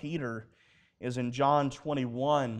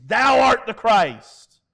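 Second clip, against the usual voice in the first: loud, slow, raised declaration; solemn proclamation; 6 semitones higher, 10 dB louder, slower than usual. Retold or imitated. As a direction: proclaim it grandly as Peter. Peter's confession acted out.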